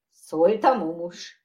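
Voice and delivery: ordinary delivery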